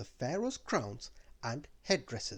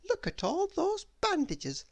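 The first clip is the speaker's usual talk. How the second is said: put-on much higher, bouncy girl voice; Little Miss Somersault; 4 dB louder than usual. Little Miss Somersault marvelling at the mummy, high bouncy voice. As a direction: pipe it up like an eager little girl.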